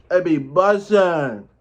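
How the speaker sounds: slow, high, loud hype; drawn-out hype. Shown as a demonstration. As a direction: stretch it out, savoring it. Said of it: "It be bussin'".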